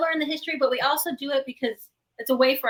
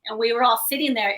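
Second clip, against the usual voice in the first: ordinary talk, nothing marked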